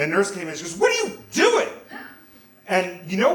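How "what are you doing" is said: high, loud shout of alarm; shocked scolding. Retold or imitated. Nurse catching a teenager with the camera, shocked. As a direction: shriek it as if catching someone red-handed.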